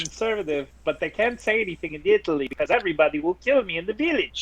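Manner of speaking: put-on fast, chatty Italian accent. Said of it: "I love your Italian accent".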